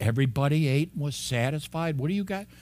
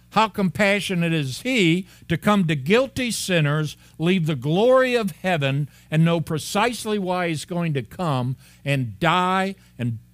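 louder, higher, rising preacher's force; fervent preaching; 6 semitones higher, 5 dB louder than usual. Acted. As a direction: proclaim it with building fervor. Preacher builds to full force.

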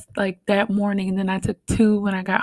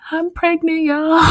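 high, joyful reveal on the phone; retold happy news to the moms on the phone; excited announcement; 8 semitones higher, 3 dB louder than usual. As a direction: burst out the news. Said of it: "And I was like".

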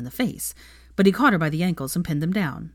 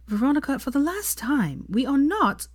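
exasperated; 9 semitones higher than usual. Acted. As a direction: plead with strained patience. Exasperated protest.

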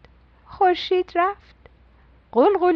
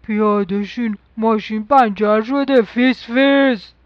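a hint of a lower father voice; lightly voiced papa; 4 semitones lower than usual. A narrator's reading. Papa telling his family the tyre is flat, lightly lower. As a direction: play papa, a touch lower. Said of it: "بابا قوله گفت".

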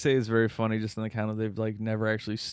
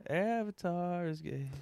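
deadpan; 6 semitones higher, 6 dB quieter, slower than usual. Pretend. Repeats deadpan joke.

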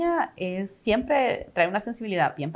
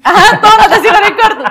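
much higher and louder through a laugh; 12 semitones higher, 16 dB louder, faster than usual. Real, not acted. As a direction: laugh it out, fast. Laughing playful answer. (riendo a carcajadas, rápido)

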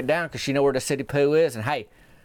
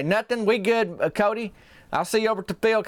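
higher, hurried brush-off; flustered brush-off; 9 semitones higher than usual. Retold or imitated. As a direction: wave him off, embarrassed. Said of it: "And Myron say".

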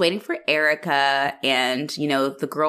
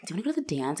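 soft, bashful asking; shy; 8 dB quieter, much faster than usual. Retold or imitated. Shy boy asking a girl to the dance, soft and bashful. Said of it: "he's being all, like, shy".

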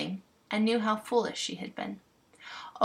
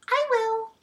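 very high, squeaky fairy voices; tiny fairy chorus; 4 dB louder than usual. Acted. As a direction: chirp like a crowd of fairies. All fairies answer together.

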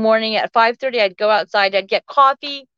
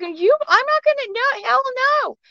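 much higher-pitched, worked-up protest; retold indignant refusal; 13 semitones higher than usual. Woman to friend urging calm, retold indignant refusal. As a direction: re-enact a flustered refusal, pitch shooting up.

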